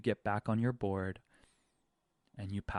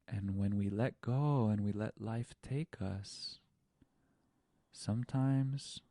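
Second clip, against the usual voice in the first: ordinary talk, nothing marked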